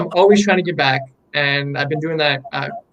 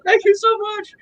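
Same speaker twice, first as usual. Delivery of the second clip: squealed thanks. Retold or imitated. Artist overjoyed as his first collector buys two paintings, squealing. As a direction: gush it out sky-high like a thrilled fan. Retelling joy at a big sale.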